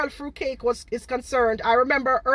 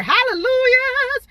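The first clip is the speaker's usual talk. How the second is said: high, slow sing-song hallelujahs; mock churchy hallelujah; 13 semitones higher, 7 dB louder, slower than usual. Mocking critics as saints, with a high hallelujah. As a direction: ham up a holy-roller cry.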